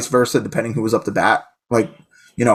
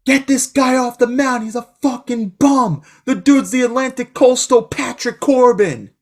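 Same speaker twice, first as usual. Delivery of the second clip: heckling; 10 semitones higher, slower than usual. Retold or imitated. Heckling the opposing pitcher.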